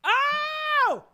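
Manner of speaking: high, loud gasp of shock; a sudden yelp of disbelief; shocked cry. Real, not acted. Shocked at the revelation.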